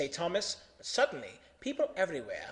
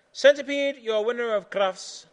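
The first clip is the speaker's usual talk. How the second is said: loud, high ceremonial announcement; announcing the winner; 6 semitones higher, 8 dB louder than usual. Acted. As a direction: proclaim it to the whole arena.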